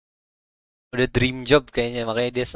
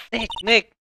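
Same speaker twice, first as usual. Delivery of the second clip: higher, eager Nick Nick; relieved rush; 7 semitones higher than usual. Acted. Maya checking on Phoenix after the tiger, eager. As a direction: rush to him, worried then relieved.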